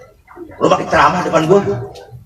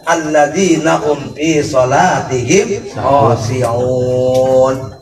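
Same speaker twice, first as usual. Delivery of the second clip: slow, lower chanted recitation; Quran recitation; 3 semitones lower, slower than usual. Shown as a demonstration. Preacher reciting verses as in prayer, slow chanted. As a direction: draw out each verse like in prayer.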